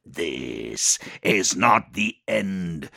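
slow, menacing demon. Acted. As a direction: drag out the threat like a demon. Demon's parting threat.

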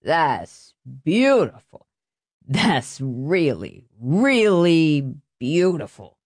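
put-on very slow, slurred drunken praise. A narrator's reading. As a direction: drag the words out like a drunk. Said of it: "a slurred voice".

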